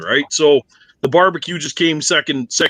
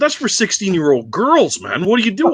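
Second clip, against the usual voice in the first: higher, scoffing put-down; teller's retold jab at the coworker, higher and scoffing; mock scoffing; 6 semitones higher than usual. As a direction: tease him like it's ridiculous. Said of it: "I said".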